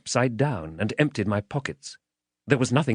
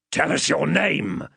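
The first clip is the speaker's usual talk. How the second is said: higher, threatening demand; menacing man; 7 semitones higher than usual. Acted. Man demanding the child's name, menacing. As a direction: play a rough interrogator bullying a child.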